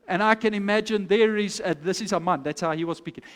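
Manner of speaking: normal talking voice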